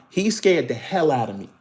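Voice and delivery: normal talking voice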